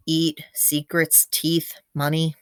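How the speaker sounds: ordinary delivery, nothing marked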